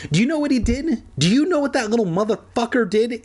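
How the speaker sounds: high, furious shriek. A narrator's reading. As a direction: shriek it out in outrage. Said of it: "Andy shrieked".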